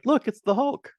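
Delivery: high, loud, excited pointing-out; playful high-pitched recognition; mock excitement. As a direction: call it out like a thrilled fan. Acting out spotting a famous actor.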